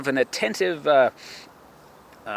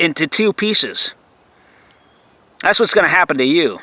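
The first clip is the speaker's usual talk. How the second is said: louder, menacing rant; furious raised-voice warning; loud threat; 6 dB louder than usual. Real, not acted. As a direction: shout the threat at him.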